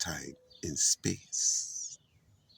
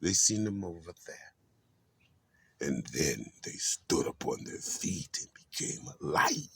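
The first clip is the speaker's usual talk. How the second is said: ordinary talk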